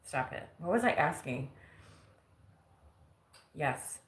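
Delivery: normal talking voice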